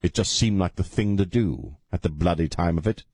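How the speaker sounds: lightly put-on British accent. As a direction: toss it off in a jokey British voice. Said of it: "talking in a British accent".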